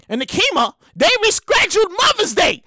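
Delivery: normal talking voice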